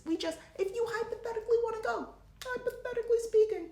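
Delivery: teasing high-pitched hypothetical; very high squeal. Real, not acted. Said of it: "talking like this a little bit".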